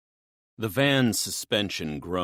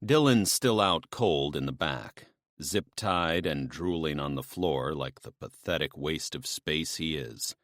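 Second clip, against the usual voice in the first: usual speaking voice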